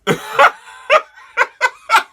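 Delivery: shrieking laugh way up high, then falling; cracking up at the masochist joke; high burst of laughter. Real, not acted. Laughing loudly while reading a manga scene.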